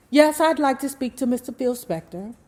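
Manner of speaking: a lightly voiced, overly proper grown-up phone voice; sit up straight and sound like an adult on the phone; most grown-up manner. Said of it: "said in her most grown-up manner".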